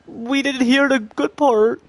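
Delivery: high, slow whine of a let-down viewer; whiny complaining viewer. Retold or imitated. Viewers complaining they missed the good part, high slow whine. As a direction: pitch it way up and drag it out like a disappointed fan. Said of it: "Meaning, like".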